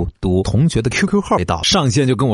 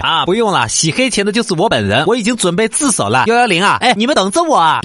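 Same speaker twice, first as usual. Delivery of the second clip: cheeky fake confession; 9 semitones higher than usual. Mocking scam caller on phone.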